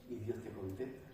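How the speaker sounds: ordinary talk, nothing marked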